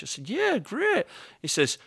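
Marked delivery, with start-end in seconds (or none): 0.3-1.0 eager yeah. Retold or imitated. Eager reply to critic.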